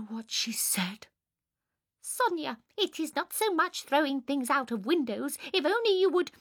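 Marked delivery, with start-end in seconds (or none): 2.0-6.3 high-pitched imitation of a reproachful mother; scolding mamma. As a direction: lift the voice high to quote mamma's complaint. Sonia quotes her mamma scolding.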